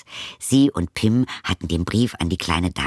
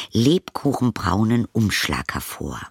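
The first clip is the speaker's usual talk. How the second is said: usual speaking voice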